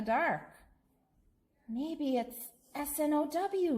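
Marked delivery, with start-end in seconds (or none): 1.7-3.8 high, curious spelling out of the letters; small bear voice. Acted. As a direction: spell it like a wondering little bear.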